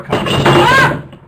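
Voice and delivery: very high and loud squeak; squeaky voice. Pretend. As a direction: squeak the refusal. Silly squeaky refusal.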